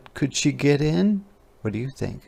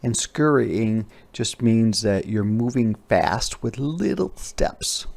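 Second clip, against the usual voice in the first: ordinary delivery, nothing marked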